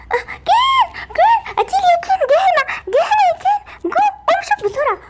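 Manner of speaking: very high, terrified calling out; panicked cry. Acted. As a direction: shriek for help in a panic. Barbie panics, calls Ken. (صراخ رعب واستغاثة)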